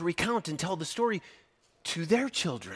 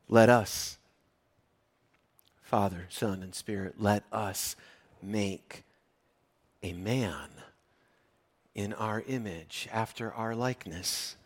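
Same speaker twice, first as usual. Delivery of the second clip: quiet voice of God; 6 semitones lower, 7 dB quieter than usual. Acted. God speaking to Father, Son, Spirit.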